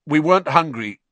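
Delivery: emphatic protest; said loudly. A narrator's reading. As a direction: insist loudly. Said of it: "said Ron loudly".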